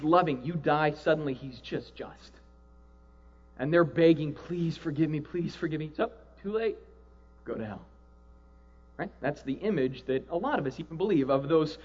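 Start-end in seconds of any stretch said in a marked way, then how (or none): 4.3-5.9 quiet, pleading murmur; quiet mock pleading; soft begging. Begging God after death. 6.4-7.8 cold dismissal. Acted. God's dismissal.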